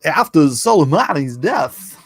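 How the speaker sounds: loud, swooping mock headline; put-on voice. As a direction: ham up the headline in a silly voice. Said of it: "I gotta stop doing that".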